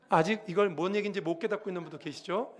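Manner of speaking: ordinary delivery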